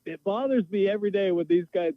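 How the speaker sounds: usual speaking voice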